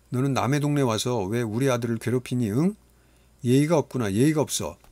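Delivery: put-on a mother snapping at the boy; sharp scolding. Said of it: "날카롭게 쏘아붙였다". (날카롭게 쏘아붙이는 엄마)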